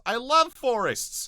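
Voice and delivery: high, loud, slow gush. Real, not acted. Streamer answering chat who mocked Sweden, high slow gush. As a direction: swoon over forests.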